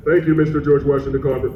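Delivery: low, quick, droning rattle-off; perfunctory low-pitched mumble-through, done as a bad example; low offhand thanks. Shown as a demonstration. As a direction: rush through it low, with no lilt. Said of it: "you can't say it like this".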